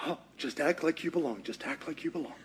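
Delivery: hushed self-talk, trying to blend in; muttering to himself. Retold or imitated. Peter sneaking in, telling himself softly to blend in. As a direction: quietly coach yourself to act casual.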